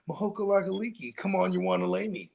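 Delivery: lightly hammed-up ad voice; mock a cheesy travel pitch. Friend retelling a movie scene, hammed-up pitch voice. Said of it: "They're like".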